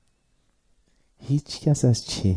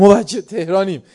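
higher, louder snooty claim; mock boast; 7 semitones higher, 7 dB louder than usual. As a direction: show off like a city kid sneering. Classmate boasting about being Tehrani.

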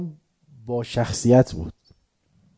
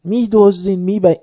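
high, loud accusing cries; accusing shout; 8 semitones higher, 5 dB louder than usual. Retold or imitated. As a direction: hurl the accusation at the shah's men. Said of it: "شروع کرد داد زدن". (فریاد اتهام)

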